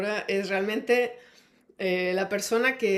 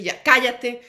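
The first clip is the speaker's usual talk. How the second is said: sudden loud burst, imitating an angry scolding; imitated outburst of shouting at kids; explosive mock yell; 3 semitones higher, 8 dB louder, slower than usual. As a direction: snap it out loudly, as if yelling at children. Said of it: "gritándole así, explosivamente".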